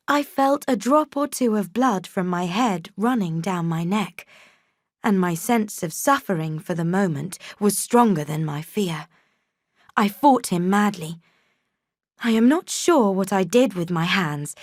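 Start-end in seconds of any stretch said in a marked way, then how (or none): none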